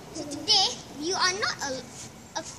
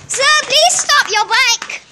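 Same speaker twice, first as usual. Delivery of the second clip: high, slow, drawn-out shout; calling out; 8 semitones higher, 4 dB louder, much slower than usual. Pretend. Police officer chasing a cyclist, calling out high. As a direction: call after someone riding away, stretching the words.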